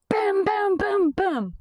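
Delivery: high, sharp boom boom like fists on a door; mock banging on the door. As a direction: pound out the knocks with your voice. Imitating knocking on van.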